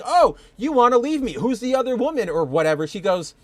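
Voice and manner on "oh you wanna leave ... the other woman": mock jealous accusation, higher and louder; hammed-up partner flipping out at him; playful imitation of a jealous outburst; jealous freak-out. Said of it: "her flipping out and going".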